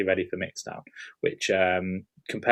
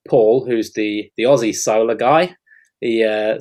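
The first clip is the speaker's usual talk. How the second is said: a mild, hammed-up Australian accent, a bit louder; Aussie accent; 6 dB louder than usual. Describing Paul the solar guy in a terrible Aussie accent. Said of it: "sorry, that's a terrible Aussie accent".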